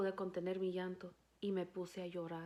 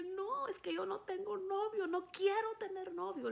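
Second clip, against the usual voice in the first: put-on high girlish protest; 10 semitones higher than usual. Teen daughter protests to father.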